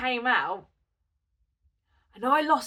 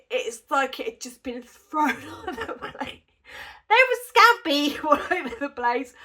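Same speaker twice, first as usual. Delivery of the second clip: words bubbling over with real laughter; laughing while telling; 2 semitones higher than usual. Real, not acted. Speaker recalling scampi thrown on the plate, laughing. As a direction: crack up midway through describing it.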